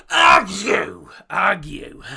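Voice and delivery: a loud, sharp fake sneeze shouting the name; mock sneeze. Imitating the player sneezing his own name loudly. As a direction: sneeze the name out like a big achoo. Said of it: "he goes".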